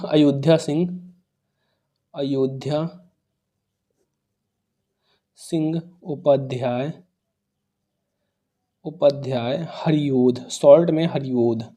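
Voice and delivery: low, soft, slow, with long gaps; drawn-out, hushed word-by-word dictation; murmuring while writing. Real, not acted. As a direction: say it quietly to yourself as you write. Repeats name slowly while writing it.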